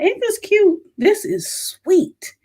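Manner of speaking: high, slow cooing over a cute thing; high, slow gushing coo. Real, not acted. Showing off her knife.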